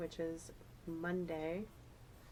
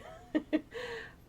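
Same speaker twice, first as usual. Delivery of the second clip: breathy high laugh. Real, not acted. Laughs at her own emotion.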